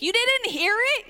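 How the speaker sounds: high-pitched disbelieving question; mock incredulity. Relative to the heavy sleeper, incredulous. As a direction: act astonished, pitch climbing high.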